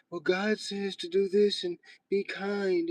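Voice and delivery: high, soft, slow pious voice; slow it down, sweetly fake and proper; mock proper churchgoer. Streamer mocking Christians talking about God, fake proper. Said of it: "start trying to talk proper and everything".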